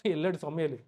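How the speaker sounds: ordinary talk, nothing marked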